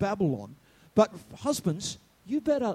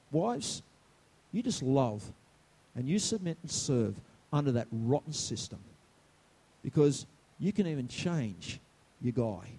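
softly tender; 7 semitones lower, 5 dB quieter than usual. Real, not acted. Tender aside to wives.

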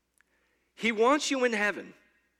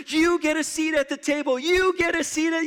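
higher, bright host greeting each person; cheerful welcome; 7 semitones higher, 3 dB louder than usual. Retold or imitated. Preacher voicing Jesus greeting crowds, cheerful and higher. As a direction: hand out seats like a happy host.

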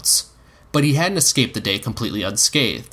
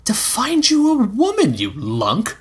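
put-on higher, slow teasing boy; cheeky boy; 10 semitones higher, slower than usual. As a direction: tease him like a brat. Egg mocking Dunk.

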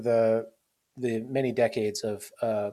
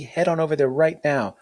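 usual speaking voice

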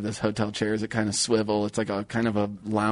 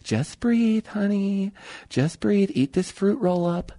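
higher, gentle mom coaxing; soothing mom; 7 semitones higher, much slower than usual. Retold or imitated. Mom soothing her sick son outside the bathroom, higher. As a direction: soothe like a mother at the door. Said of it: "she'd be like".

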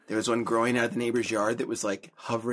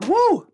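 whoop; 11 dB louder than usual. Real, not acted. Reacting to spicy drink.

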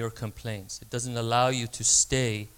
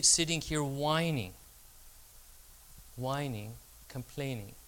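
ordinary talk

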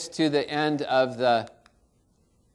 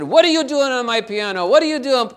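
a hint of an angry master, louder; mock scolding; 10 semitones higher, 10 dB louder than usual. Pianist scolds boy on his piano, mock angry shout. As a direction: snap at the kid as if annoyed.

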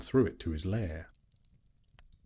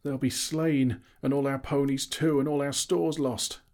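higher, louder lament; moaning; 8 semitones higher, 4 dB louder than usual. A narrator's reading. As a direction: wail over everything about to be lost. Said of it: "moaned the others".

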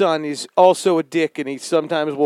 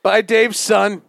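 dramatic, louder and higher; passionate; 4 semitones higher, 4 dB louder than usual. Acted. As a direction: tell it like a grand exposé. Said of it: "more and more passionate".

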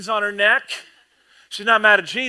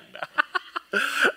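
high girlish mock giggle; a swooning little giggle; 9 semitones higher, 3 dB quieter than usual.

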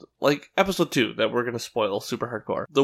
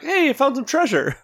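put-on much higher, gleeful little boy; excited kid voice; 13 semitones higher than usual. Friend imitating the little brother finding treasure. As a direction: squeal it like a kid finding loot.

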